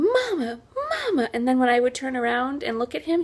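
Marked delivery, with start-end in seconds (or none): 0.0-1.3 put-on whiny toddler calling Mama, pitched high; imitating child yelling. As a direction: mimic a crying kid calling Mama. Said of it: "He followed me around the house yelling".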